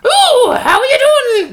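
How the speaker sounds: very high, loud put-on innkeeper; piercing falsetto innkeeper's greeting; busty innkeeper. Shown as a demonstration. Showing a friend a D and D stock voice, busty innkeeper. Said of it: "busty innkeeper".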